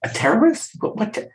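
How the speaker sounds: rising astonished echo of a word; startled retold disbelief; shocked disbelief. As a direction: voice jumping up in disbelief. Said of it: "I said".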